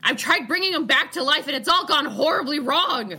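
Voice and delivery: high, loud, frantic scolding. Acted. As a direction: snap at the idiot in a panic. GM voices panicked NPC.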